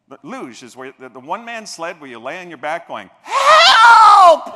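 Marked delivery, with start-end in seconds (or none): 3.2-4.5 high loud comic yell for help; mock scream. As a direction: shriek like you're flying down the sled. Screaming down the luge.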